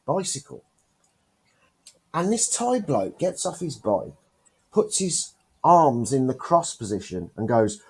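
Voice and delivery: normal talking voice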